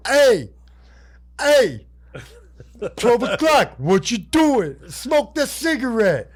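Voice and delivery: hammed-up drunken holler, stretching the words out; drunk Dice Man. Drunk Dice Man calling out in the cage, hammed-up and loud. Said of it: "He's drunker than shit".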